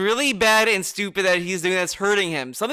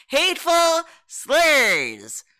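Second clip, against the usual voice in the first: mock official excuse; 8 semitones higher, much slower than usual. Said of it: "he was just told".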